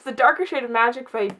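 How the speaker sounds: ordinary delivery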